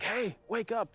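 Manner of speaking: high, urgent call to wake a fallen friend; calling him back up, pitched high. Retold or imitated. Team leader kicking him awake.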